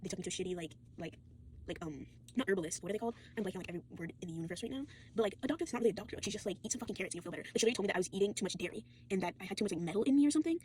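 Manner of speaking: ordinary talk, nothing marked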